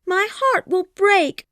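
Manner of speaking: high, slow dramatic wail; scream. Acted. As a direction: wail it melodramatically. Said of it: "screamed Kitty".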